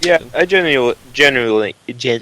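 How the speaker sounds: real tongue-tied stutter. Real, not acted. Podcaster wired on energy drinks signing off, stuttering. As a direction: trip over the word and keep restarting it. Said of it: "I'm, I'm stuttering again".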